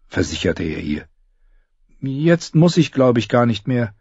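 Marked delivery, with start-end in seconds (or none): 2.0-3.9 put-on young girl voice. Arya's voice in dialogue.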